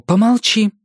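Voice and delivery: curt cut-off. A narrator's reading. Mother silencing Erik, sharp loud cut-off. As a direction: shut him down curtly. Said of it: "отрезала Джеки".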